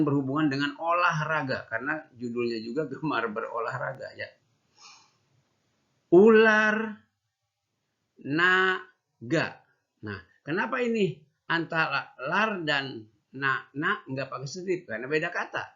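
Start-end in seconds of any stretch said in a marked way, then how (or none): 6.1-9.5 "Ular" loud and high, then "naga" falling; syllable drill. Shown as a demonstration. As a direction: read slowly word by word, for children to repeat. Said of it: "Yuk ulangi, ikuti".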